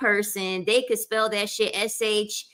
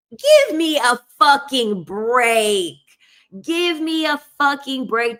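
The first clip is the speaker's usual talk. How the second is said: exasperated outburst; 5 semitones higher, 6 dB louder than usual. Retold or imitated. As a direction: act out being totally fed up. Acted exasperated reaction to patient.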